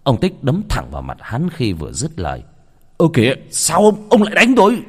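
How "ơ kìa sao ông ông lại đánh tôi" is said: high, loud, startled outcry; just been hit, demanding why; shocked protest. Acted. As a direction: yelp in indignant disbelief. Man protests at being punched.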